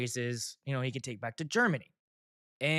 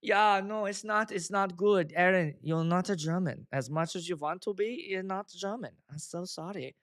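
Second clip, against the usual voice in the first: Tommy Wiseau. Pretend. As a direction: apologize in a bad German accent. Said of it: "I sound a lot like Tommy Wiseau".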